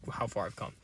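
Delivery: normal talking voice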